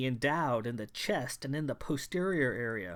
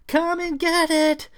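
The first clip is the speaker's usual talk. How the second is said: very high, loud beastly taunt; 11 dB louder than usual. Acted. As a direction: shriek the dare as she runs off. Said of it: "she taunted".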